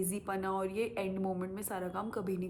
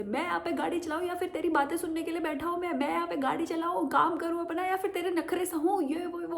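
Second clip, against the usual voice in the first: higher, louder, fast scolding rant; angry driver rant; 9 semitones higher, 4 dB louder than usual. Retold or imitated. Bike driver scolding girlfriend on phone while riding, angry and fast. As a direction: rant like a fed-up boyfriend. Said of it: "ऐसे चलाते".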